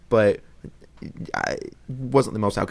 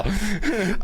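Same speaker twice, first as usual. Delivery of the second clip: nervous laugh; 10 semitones higher than usual. Real, not acted. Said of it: "That was real".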